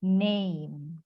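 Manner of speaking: "name" slowly drawn out as neym; correct NAME. Shown as a demonstration. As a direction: model "name" with a long ay glide. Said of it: "Coba ulangi".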